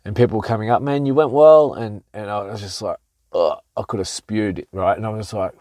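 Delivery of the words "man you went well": louder, higher, cheery mock pat on the back; upbeat well-wisher. Gym members congratulating the losing boxer, hearty cheer. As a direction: sound like a friend hyping you up, higher. People greeting him after fight.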